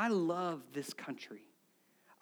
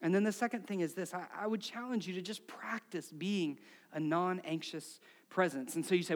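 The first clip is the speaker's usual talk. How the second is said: usual speaking voice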